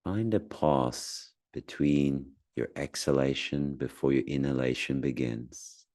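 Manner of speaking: calm, spacious delivery with long silences; unhurried meditation voice, phrase by phrase; guided meditation. Acted. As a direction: gently guide someone through noticing their breath. Leads a guided breathing meditation.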